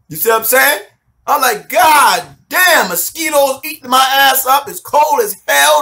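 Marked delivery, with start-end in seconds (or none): 1.7-5.8 higher, louder comic griping; exasperated complaint. Retold or imitated. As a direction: complain loudly in a raised pitch. Acted complaint about hardship.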